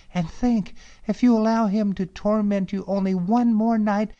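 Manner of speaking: high, sweet pleading princess voice; cartoon princess. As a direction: coax him like a fairy-tale princess.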